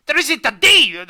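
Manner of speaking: high, loud unhinged trainer voice; crazy voice. Acted. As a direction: yell it like a lunatic. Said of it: "go for a crazy voice".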